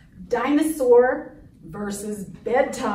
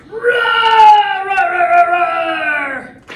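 full dinosaur roar; 5 semitones higher, 10 dB louder than usual. Acted. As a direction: let rip like a dinosaur. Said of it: "gonna be lots of roaring".

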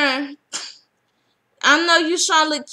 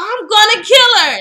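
higher, louder threat; mock furious threat; 7 semitones higher, 7 dB louder than usual. Karen spots Natalie and threatens her, loud and high. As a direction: spit out the threat. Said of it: "She's like".

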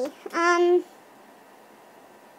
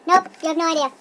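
ordinary delivery

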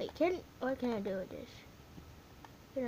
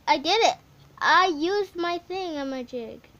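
loud, proud, higher victory shout; excited cheer; 4 semitones higher, 11 dB louder than usual. Real, not acted. Kid gamer cheering a win to viewers, loud and proud. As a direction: announce the win loudly.

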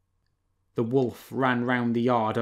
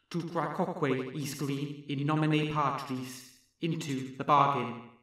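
strange distant voice; 4 semitones higher, 8 dB quieter than usual. Acted. Distant voice from the well.

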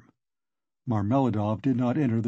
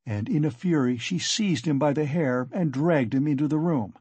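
plain reading voice

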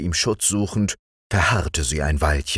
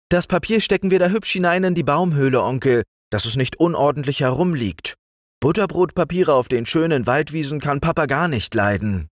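put-on high, quick, bright little girl voice; a cheerful young girl reminding her uncle; little girl Liesel; 9 semitones higher than usual. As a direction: chatter like a tidy, eager child.

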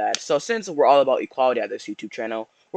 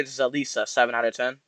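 usual speaking voice

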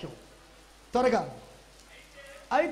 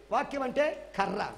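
usual speaking voice

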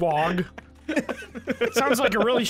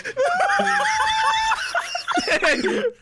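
high shrieking laugh; falsetto laugh. Real, not acted. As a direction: squeal with laughter. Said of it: "hit the falsetto and then laugh".

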